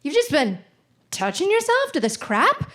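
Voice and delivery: mock horror from a fed-up mother; disgusted mom. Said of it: "she's just like".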